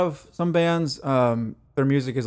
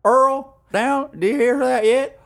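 high, louder hammed-up Southern holler; Southern accent; 12 semitones higher, 5 dB louder, slower than usual. Co-host joins the bit, calling out in a Southern accent. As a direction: call out to Earl like a country neighbor. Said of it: "Oh, y'all".

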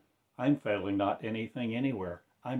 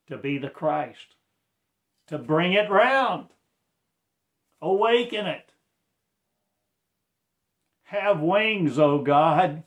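high, loud ecstatic cry; ecstatic preaching; 7 semitones higher, 11 dB louder than usual. Acted. Preacher crying out to God in ecstatic full voice.

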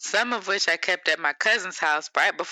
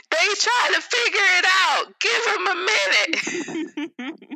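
high, raised-voice exasperation; exasperated outburst; 10 semitones higher than usual. Acted. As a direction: burst out in defence of the kid. Mock telling off impatient adults.

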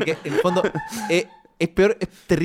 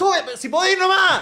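coach yelling; 14 semitones higher, 7 dB louder than usual. Retold or imitated. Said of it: "el D T grita al lateral".

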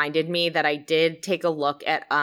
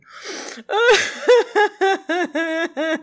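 laughing through tears; teary laugh while reading; cry laughing; 11 semitones higher, 3 dB louder than usual. Real, not acted. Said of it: "Cry laughing".

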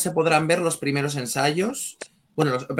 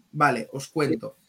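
ordinary talk, nothing marked

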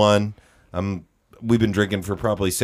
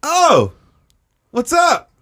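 high, loud call down; acted long-distance greeting; calling back; 6 dB louder, much slower than usual. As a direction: holler back from a balcony. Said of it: "yells at me, and I'm like".

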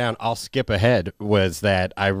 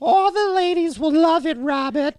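hammed-up mom accent, very high; shrieky caricature of a mother in a silly accent; Diane; 3 dB louder than usual. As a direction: gush like a cartoon mom with a put-on accent. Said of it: "I know you don't sound like that".

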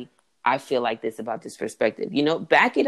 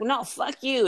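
mock retort; 10 semitones higher, slower than usual. Acting out the other person answering an insult, mock retort. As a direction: fire back in a put-on high voice.

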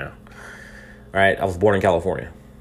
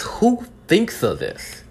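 much higher, very slow incredulous question; high, slow disbelief; 12 semitones higher, much slower than usual. Real, not acted. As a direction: pitch it way up in stunned disbelief. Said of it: "'cause I'm so angry".

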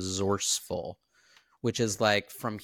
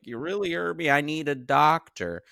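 playing victim; 6 semitones higher, 5 dB louder than usual. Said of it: "play like I'm Danny".

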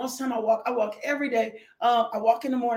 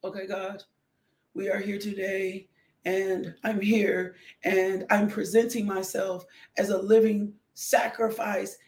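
mock piety, measured and subdued, pitched down; stiff dutiful churchgoer; 6 semitones lower than usual. Trying to show up before God, stiffly solemn. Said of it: "me trying to show up like".

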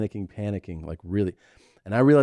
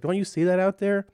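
high-pitched insistent question, imitated; retold sister pressing the driver to look; sister's urgent question; 11 semitones higher than usual. Sister urging driver to look at field, insistent. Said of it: "And she's like".

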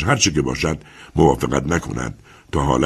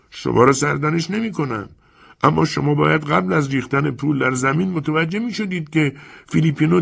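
put-on a calm, sly old lender talking down to the young man; old moneylender Chiarenza; 11 semitones higher than usual. Chiarenza explains coolly.

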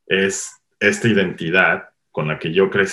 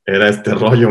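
raised, emphatic insisting; retelling the uncle's protest; 4 semitones higher, 5 dB louder than usual. Retold or imitated. Painter answering the Parisians, raised and insistent. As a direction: protest that it's just how he grew up. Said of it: "Y él decía".

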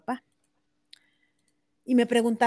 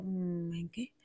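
a near-whisper, much softer; dry reply; 5 semitones lower, 12 dB quieter, slower than usual. Retold or imitated. Mother answering the crying friend's "have faith", hushed whisper. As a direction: let it drop to a hushed, doubtful murmur.

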